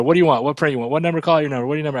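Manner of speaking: rapid-fire calls for numbers; auctioneer. Retold or imitated. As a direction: rattle off bids like an auction. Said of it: "I felt like an auctioneer".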